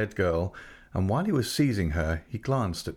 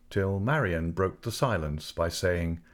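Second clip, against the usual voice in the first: usual speaking voice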